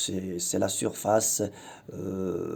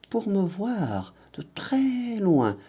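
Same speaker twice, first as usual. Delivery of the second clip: whispered line; 11 semitones higher than usual. Shown as a demonstration. Whisper inside the poem reading.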